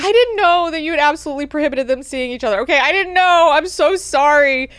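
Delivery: high, loud, rushed excuses slowing into apology; hammed-up frantic apology; panicked apology. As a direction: plead innocence in mock panic. Frightened servant apologises to angry lord.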